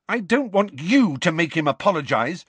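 Lily shouting at James, rounding on him. Acted. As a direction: shout back at him in protest. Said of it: "Lily shouted".

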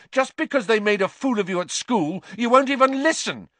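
high, strained accusation, building; angry yell. A narrator's reading. Harry accuses Snape, yelling. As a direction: yell on, pitch climbing. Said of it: "Harry yelled".

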